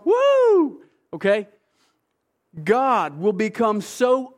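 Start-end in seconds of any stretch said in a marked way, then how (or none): 0.0-0.8 a sudden high, loud whoop; excited yell. Retold or imitated. Someone so excited they spin and yell, loud whoop. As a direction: whoop like someone spinning with joy.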